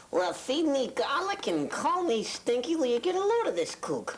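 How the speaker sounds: a sassy dummy turning to the audience with the punchline; wisecracking dummy. Retold or imitated. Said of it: "Joe Flip would turn and look at the audience and say".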